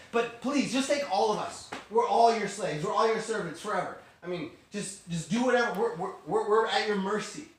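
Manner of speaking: frantic acted plea; desperate pleading. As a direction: plead urgently for mercy. Brothers begging to be enslaved together.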